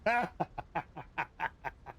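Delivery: long run of chuckles; rolling chuckle. Real, not acted. Amused that Judge is out there, rolling chuckle. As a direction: chuckle on in even bursts.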